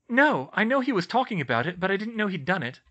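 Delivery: bright and keen, offering to help; Hermione's voice. A narrator's reading.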